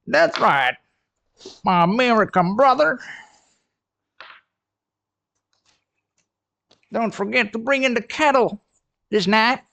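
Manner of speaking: high, loud hammed-up American accent; joking American accent for a viewer from Texas; bad American accent. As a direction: play a Texan, badly and proudly. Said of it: "my horrible American accent".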